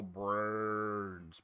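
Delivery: low, soft zombie moan; menacing zombie. Retold or imitated. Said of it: "the stereotype for Return of the Living Dead is that the zombies go".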